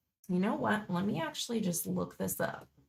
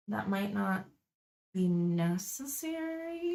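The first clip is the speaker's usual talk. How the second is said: tentative, with NECESSARY lifted and lengthened at the end; questioning. Real, not acted. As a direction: turn it into an unsure question, stretching NECESSARY. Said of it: "question mark?".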